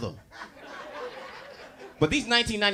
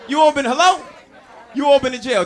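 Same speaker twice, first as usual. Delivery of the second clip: teasing crowd; 7 semitones higher, 8 dB louder than usual. Real, not acted. Teasing the audience.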